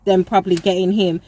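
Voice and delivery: usual speaking voice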